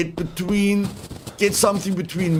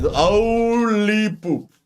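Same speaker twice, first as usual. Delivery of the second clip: drawn-out amazement; 6 semitones higher, much slower than usual. Real, not acted. Drawn out relief.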